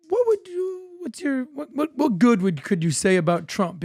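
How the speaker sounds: lightly acted careful question, high and halting; tentative mock-delicate probe, settling toward normal pitch; hesitant asking.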